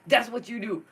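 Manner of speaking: usual speaking voice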